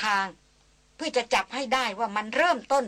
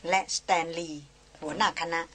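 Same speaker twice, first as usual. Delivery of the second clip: ordinary delivery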